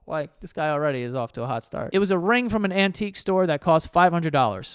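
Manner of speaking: ordinary talk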